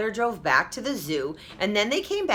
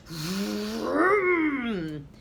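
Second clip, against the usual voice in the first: vroom; 3 semitones higher than usual. A narrator's reading. Car sound in the story.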